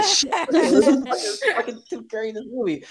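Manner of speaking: very high squeal of laughter; cracking up in disbelief, very high and loud; laughing disbelief. Real, not acted. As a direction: shriek it out, laughing.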